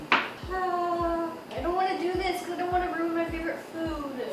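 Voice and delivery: high, soft, hurried whine; reluctant whine. Real, not acted. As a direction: whine it in dread, high and small. Dreads putting food on skin.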